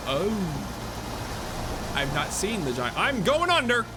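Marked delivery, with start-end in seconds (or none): 3.0-3.9 louder, higher alarmed cry; sudden panic as the boat sinks. Real, not acted. Boat sinking in game.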